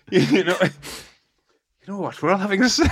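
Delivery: slow, high Welsh voice breaking into laughter; Welsh accent. Retold or imitated. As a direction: drag it out in a Welsh lilt while laughing. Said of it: "how do you do a Welsh accent again?".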